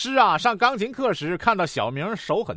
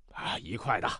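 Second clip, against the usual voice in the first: low, very soft reply; calm doctor; 12 semitones lower, 12 dB quieter than usual. Acted. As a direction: answer quietly in a deep physician's voice. Doctor voiced against anxious patient.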